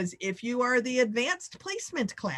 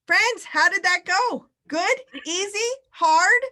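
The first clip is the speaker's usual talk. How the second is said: high, loud, bright welcome back; high and loud, cheering everyone back in; excited greeting; 10 semitones higher, 6 dB louder than usual. Real, not acted. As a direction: greet the room with a burst of energy. Host greets group after break, excited.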